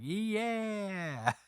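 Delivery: high mock cheer of the crowd; a quick hammed-up crowd yeah.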